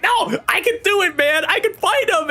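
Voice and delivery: high, pleading voice; squeaky protest; whiny Loki. Retold or imitated. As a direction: whine it like a desperate hero begging to fight.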